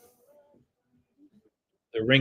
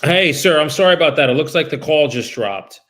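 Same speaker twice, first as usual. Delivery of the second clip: higher, louder, polite cold-call voice; phone sales voice; 6 semitones higher, 9 dB louder than usual. Real, not acted. Broker cold-calling a homeowner about his listing, upbeat phone sales voice. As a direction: brighten up and pitch yourself to the homeowner.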